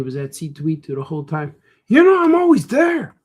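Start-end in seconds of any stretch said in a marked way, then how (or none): 1.9-3.1 high, loud taunting yell; critic yelling. Acted. The critic character taunts the cartoonist, high loud yell. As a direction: taunt him in a high shout. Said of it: "he has to yell at me all the time".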